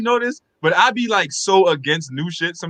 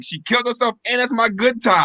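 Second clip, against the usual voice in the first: high-pitched comic indignant father; imagined dad; 6 semitones higher than usual. Acted. Co-host imagines dad finding out, high comic indignation. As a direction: raise the pitch, aggrieved over the good tie. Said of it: "your dad probably was like".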